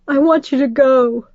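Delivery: mother crying at him. Acted. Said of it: "my mom cried".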